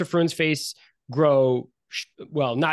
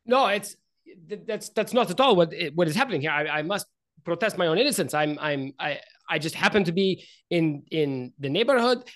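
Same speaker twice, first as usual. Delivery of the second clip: higher, stammering wealthy nobleman; flustered protesting nobleman; 4 semitones higher than usual. Acted. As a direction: protest innocence in a nervous stammer. Game master voices Rainier protesting.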